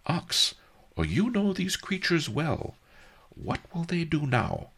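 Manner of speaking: high, lightly voiced old master; old sage's question. A narrator's reading. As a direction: ask it curious and mild. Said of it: "asked Master Li".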